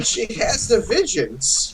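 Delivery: put-on high, slow old Brooklyn lady; foul mouthed granny. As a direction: croak it like a druggie granny seer. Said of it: "She's my old lady voice from Brooklyn".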